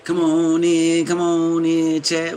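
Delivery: loud, slow, drawn-out welcome; calling out loud. Real, not acted. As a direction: call the viewers in, big and slow. Calling viewers into the chat.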